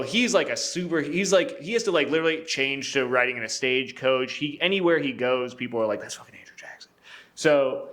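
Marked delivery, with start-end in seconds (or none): 6.0-6.9 hushed, voiceless mock whisper; imitated awed whisper. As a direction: whisper it like a starstruck onlooker. Awed onlookers voiced in a story.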